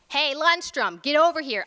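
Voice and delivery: loud call to a colleague across the room. A narrator's reading. Orderly calls colleague for help.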